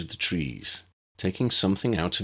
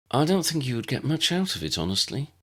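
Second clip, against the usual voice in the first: put-on lightly raised older girl voice; gently honest prefect; a hint of Tessa; 7 semitones higher than usual. A narrator's reading.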